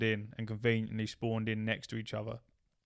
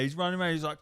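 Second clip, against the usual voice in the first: usual speaking voice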